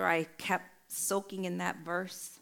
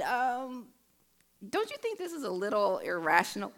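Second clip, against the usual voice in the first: high-pitched, careful husband questioning; hesitant "um", then a high, tentative challenge; imitating tactful husband; 5 semitones higher than usual. Husband questioning his wife's fear of bridges, imitating tactful husband.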